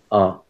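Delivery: R said as an open "ah"; the letter R, British style, ending on the vowel; British silent R. Shown as a demonstration. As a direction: say R with no R sound at the end. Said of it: "without the R".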